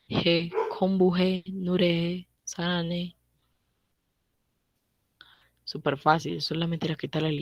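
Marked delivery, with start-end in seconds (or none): 0.1-3.2 slow model words. Shown as a demonstration. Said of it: "teacher demonstrates Korean words slowly".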